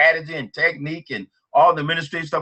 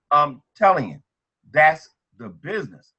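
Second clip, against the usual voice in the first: ordinary talk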